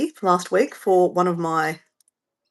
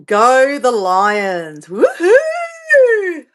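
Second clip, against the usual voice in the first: cheering; 4 semitones higher, 6 dB louder, much slower than usual. Real, not acted. Cheering her footy team.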